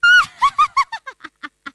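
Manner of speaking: high, loud burst of laughter. Acted. Friend on the phone laughing at her worry, uproarious laughter. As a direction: laugh helplessly at full volume.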